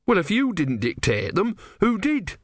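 put-on indignant young monk voice; young novice, indignant. A narrator's reading. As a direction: demand an answer from the god.

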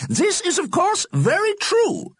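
excited, squeaky voice; high, eager squeak of an old scientist; Solomon Einstein. Acted. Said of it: "in his excited, squeaky voice".

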